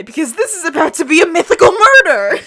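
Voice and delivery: fast dramatic shout, rising in mock panic; hammed-up alarm. A narrator's reading. Narrator reveals a coming murder to listeners, hammed-up alarm. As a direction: build to a loud, high, rushed mock-horror cry.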